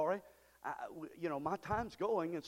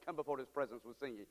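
normal talking voice

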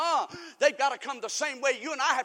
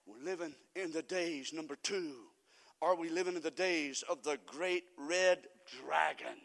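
low, soft, portentous announcement; quiet, deep pulpit delivery; hushed preaching; 7 semitones lower, 5 dB quieter than usual. Real, not acted. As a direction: drop the voice to introduce the next point. Drops voice for ominous point.

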